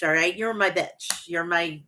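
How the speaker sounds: ordinary delivery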